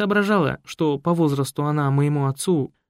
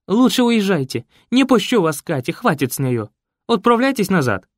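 louder, higher insistence; sending him off; 5 semitones higher, 4 dB louder than usual. Acted. Raisa orders the journalist to leave, louder. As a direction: push him away with words.

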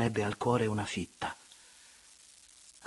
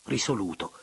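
ordinary delivery, nothing marked

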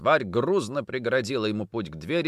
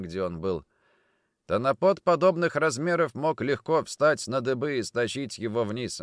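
ordinary delivery, nothing marked

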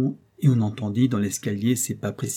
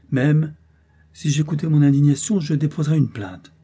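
1 mock indignation; 5 semitones higher, 6 dB louder than usual. A narrator's reading. Doctor feigns indignation.

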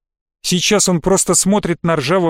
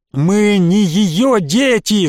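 yelling; 5 semitones higher, much slower than usual. A narrator's reading. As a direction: cry it out, stretching every word. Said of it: "заорал он". (протяжный крик)

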